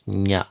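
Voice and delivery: nya, slow, a palatal n glide; nya sound. Shown as a demonstration. Teacher isolating the nya sound for chanters. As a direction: draw out nya slowly. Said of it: "this very sound".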